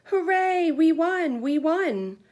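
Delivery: high, loud victory cheer; a kid's team cheering its win. A narrator's reading. Kids' team cheering after winning, high and loud. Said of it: "The other team cheered".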